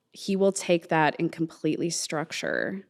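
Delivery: ordinary delivery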